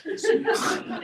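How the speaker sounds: a high, voiceless giggle; breathy laugh. Real, not acted. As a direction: giggle under your breath. Laughs about eating vegetables.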